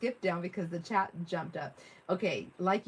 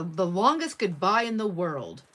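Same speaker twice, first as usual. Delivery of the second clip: emphatic read; 8 dB louder, slower than usual. Real, not acted. Reading chat with emphasis.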